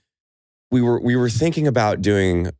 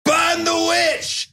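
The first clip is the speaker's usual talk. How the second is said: very high, slow mob shout; angry mob leader; much slower than usual. Retold or imitated. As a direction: yell to rouse the crowd. Townspeople at witch burning.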